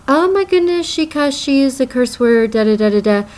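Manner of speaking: high-pitched imitation of a prim objector, tumbling down in pitch; mock scandalized. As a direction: mimic someone fussing over a swear word, starting very high. Mimicking people offended by swearing.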